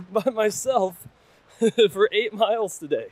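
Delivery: laughing at himself. Real, not acted. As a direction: laugh through the words. Laughs at own hike.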